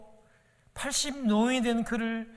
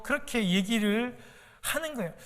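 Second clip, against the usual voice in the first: ordinary talk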